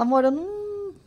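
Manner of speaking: mild imitation of husband shrugging it off; husband, casual. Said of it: "Ele fala".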